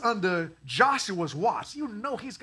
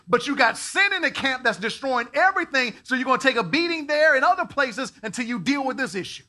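loud preaching; 6 semitones higher, 8 dB louder than usual. Retold or imitated. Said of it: "And God is like".

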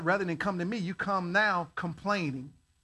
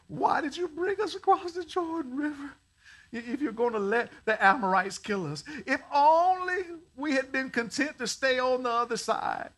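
whining; 6 semitones higher than usual. Retold or imitated. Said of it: "he whining right here".

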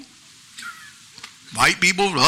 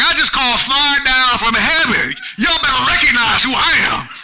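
boastful shout; 6 semitones higher than usual. Retold or imitated. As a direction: brag it out like a proud prophet. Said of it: "Be like".

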